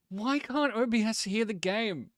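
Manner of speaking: high, slow, drawn-out complaint; exasperated whine. Real, not acted. Streamer whining to chat about missing game audio, exasperated. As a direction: whine it out in disbelief.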